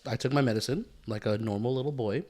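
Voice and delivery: normal talking voice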